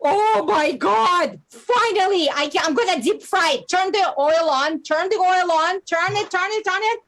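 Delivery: rising glee with each "turn it"; excited. Acted. Excited mom wants to fry bug.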